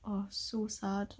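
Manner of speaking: normal talking voice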